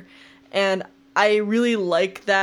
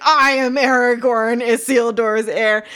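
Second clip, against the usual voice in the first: a louder, slower hammed-up heroic announcement; mock grand proclamation; 4 semitones higher, 6 dB louder than usual. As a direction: overplay a hero revealing who he is.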